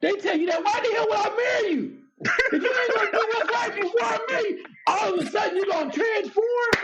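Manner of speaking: high-pitched, incredulous tirade; worked-up shouting comeback, pitch way up; indignant rant. Acted. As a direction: tell them off in a high, shouted voice. Indignant comeback to quoted partner.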